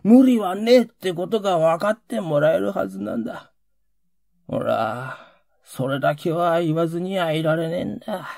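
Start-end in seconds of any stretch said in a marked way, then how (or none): none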